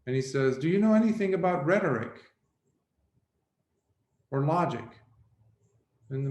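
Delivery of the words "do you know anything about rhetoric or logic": lightly voiced scholar, higher and louder; quick, higher quizzing, lightly acted; young scholar. Young scholar tests old boatman once more, lightly voiced quick quizzing.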